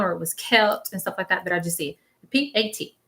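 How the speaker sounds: ordinary talk, nothing marked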